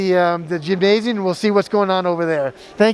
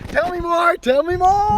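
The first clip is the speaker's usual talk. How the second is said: eager and high; 10 semitones higher than usual. Acted. Playful eager prompt.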